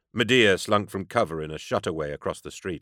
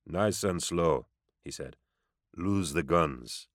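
slow menacing; 5 dB quieter, slower than usual. Acted. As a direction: warn calmly, very slowly. Gunman giving calm threat.

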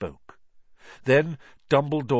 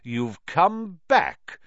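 cold furious aristocrat; 11 semitones higher, 4 dB louder than usual. A narrator's reading. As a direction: spit it out with contempt. Lucius Malfoy voiced, furious.

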